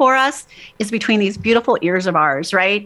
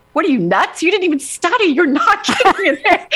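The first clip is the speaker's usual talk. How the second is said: high mocking self-talk, breaking into a laugh; inner critic voice; 8 semitones higher than usual. To herself after leaving the house, high mocking inner voice. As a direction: scold yourself in a high, laughing voice. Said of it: "I'd say to myself in my brain".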